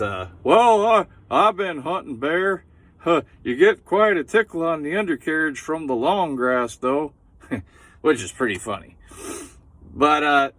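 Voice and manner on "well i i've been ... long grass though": high, loud old hunter impression; old Bob voice. Comic retelling old Bob's line, high and loud. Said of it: "and he says".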